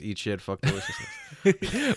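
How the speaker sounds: high, slow mock ad slogan; lightly voiced announcer, drawn out; a hint of a jingle voice; mock slogan read. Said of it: "their new slogan is".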